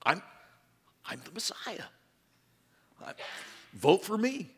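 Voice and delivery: hammed-up self-introduction; mock candidate pitch. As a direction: sell it like a politician. Mock campaign pitch as Messiah.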